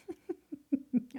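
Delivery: real embarrassed laugh; sheepish laugh. Real, not acted. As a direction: laugh at your own goof. Laughs at own mistake.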